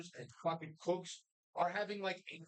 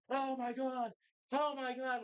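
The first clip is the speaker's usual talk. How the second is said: higher, drawn-out mock amazement; mock awe; 4 semitones higher than usual. Diners imitated hearing Robin Feld's name, mock awe. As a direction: gush as if imitating starstruck strangers. Retold awed reaction to the chef.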